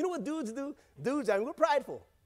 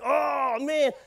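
louder, higher hyped-up oh man; mock crowd shout; 6 semitones higher, 6 dB louder than usual. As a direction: hype it like the crowd watching. Crowd reacts at breakdance burn.

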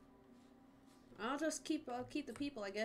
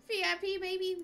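higher and louder, gloating; excited brag; 8 semitones higher, 6 dB louder than usual. Real, not acted. Streamer giving himself VIP, gleeful and loud. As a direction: crow it like a little victory.

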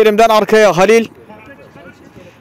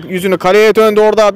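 ordinary talk, nothing marked

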